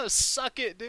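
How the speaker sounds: gloating through laughter; laughing taunt. Real, not acted.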